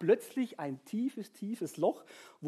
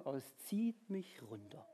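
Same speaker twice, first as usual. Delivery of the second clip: zieht mich runter sunk low and much softer; heavy and soft; 6 semitones lower, 11 dB quieter than usual. Shown as a demonstration. Preacher tells how sadness pulls him down, sinking low and soft. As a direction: let the words sag down.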